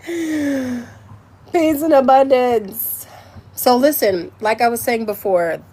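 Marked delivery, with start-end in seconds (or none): 0.1-3.1 very slow, higher-pitched intoning; long held syllables, intoned; drawn-out blessing. Acted. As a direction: stretch each word like a chant. Repeats blessing as drawn-out sign-off.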